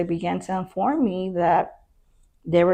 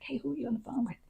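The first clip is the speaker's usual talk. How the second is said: high and soft, pointedly; a pointed question, pitched high and soft; 6 semitones higher, 10 dB quieter, much faster than usual. Retold or imitated. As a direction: ask it sweetly, knowing the answer. Catches the deputy on the phone.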